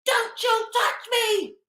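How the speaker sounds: high, shrill-free mock rant, slowing at the end; hammed-up angry passenger; mock furious shriek. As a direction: imitate her yelling don't you touch me.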